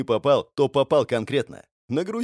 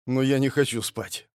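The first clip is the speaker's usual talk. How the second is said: lifeless voice. A narrator's reading. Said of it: "тем же безжизненным голосом".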